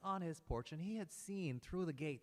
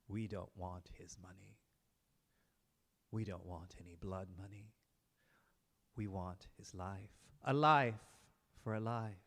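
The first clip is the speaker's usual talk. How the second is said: cold vengeful brothers; 6 semitones lower than usual. Acted. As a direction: calmly demand a life for a life. Brothers demand his life.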